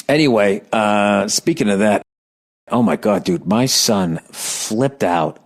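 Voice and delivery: usual speaking voice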